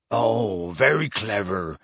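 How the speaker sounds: low, grudging growl. A narrator's reading. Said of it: "growled Hagrid".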